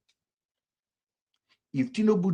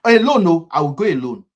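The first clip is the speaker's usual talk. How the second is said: emphatic burst; 4 semitones higher, 9 dB louder than usual. Real, not acted. Boasting about freedom to drive.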